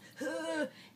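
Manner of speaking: high, tight squeeze of breath; mock strained gasp. Shown as a demonstration. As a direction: gasp as if the body clamps shut. Acting singer squeezing out of air.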